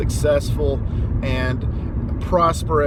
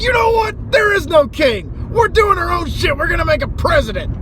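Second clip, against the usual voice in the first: high, loud rebel yell; hammed-up revolutionary roar; mock defiant shout; 14 semitones higher, 6 dB louder than usual. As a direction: shout it at the king. Colonists voiced yelling at king.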